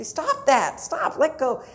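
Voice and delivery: high, loud startled parent's cry; mom yelling stop. Retold or imitated. Mother yelling at child pulling her hair, high and loud. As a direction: snap it out like your hair is being yanked.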